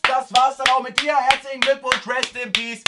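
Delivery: mock the victim's bad choice; sarcastic praise. Real, not acted. Sarcastic praise at dumb character. (ironisch gratulierend, hoch)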